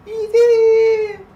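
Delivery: high, loud cheering cry; excited cheer. Real, not acted. Cheering a viewer's progress, shrieking with joy. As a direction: celebrate loudly for the viewer.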